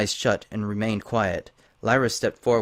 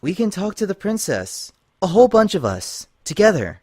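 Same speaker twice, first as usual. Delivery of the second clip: higher, earnest pony voice urging unity; Lyra pleading; 9 semitones higher, 4 dB louder than usual. Acted. Lyra urging Twilight to face the princess together, higher and pleading. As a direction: coax a frightened friend to come along.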